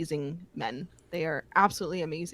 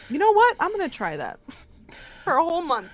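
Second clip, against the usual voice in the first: excited resolve; 9 semitones higher, 7 dB louder, faster than usual. Retold or imitated. Acted resolve quote.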